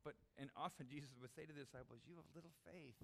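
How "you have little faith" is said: soft, stern reproof without shaming; quietly stern. Retold or imitated. Jesus says it to the disciples, stern but soft. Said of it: "It's kind of in a stern tone".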